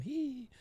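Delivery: high, soft mock titter; one thin, high "hee" imitating a giggle; small squeaky imitation giggle; background giggle. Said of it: "you hear Maria in the back going".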